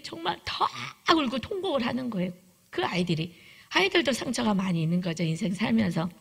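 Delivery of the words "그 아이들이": ordinary talk, nothing marked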